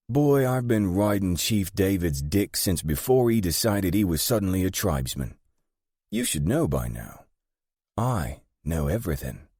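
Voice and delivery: high, forceful opening settling into assurance; scornful British gang boss. Acted. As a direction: put the young man in his place as the all-knowing woman boss.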